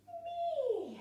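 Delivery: very high falling meow; a big stretching cat meow. Shown as a demonstration. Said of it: "And then".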